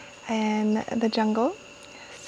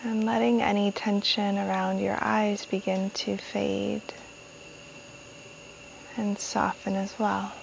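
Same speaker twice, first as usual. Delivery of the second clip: calm meditation-guide voice with long pauses; guided meditation; 6 dB quieter than usual. Real, not acted. Guiding listeners to welcome jungle sounds, calm meditation voice. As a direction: ease listeners inward, unhurried, phrase by phrase.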